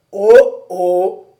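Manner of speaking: teasing loud Oh, oh; a little mocking exclamation. A narrator's reading. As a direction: tease him, lightly scoffing. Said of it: "d'un ton un peu moqueur".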